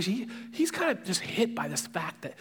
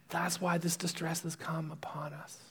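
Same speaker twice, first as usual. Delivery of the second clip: much softer, breath-only confession of guilt; hushed admission; 10 dB quieter than usual. Retold or imitated. Brother owning up to his brothers, hushed. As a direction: drop to a near-whisper.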